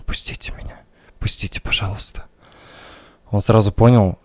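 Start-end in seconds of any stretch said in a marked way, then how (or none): 0.1-2.2 very soft, voiceless whisper; whispered repeated plea. A narrator's reading. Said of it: "она повторяла одно и то же".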